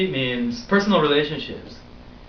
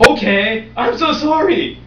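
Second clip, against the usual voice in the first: flustered apology; 13 semitones higher, 6 dB louder than usual. Retold or imitated.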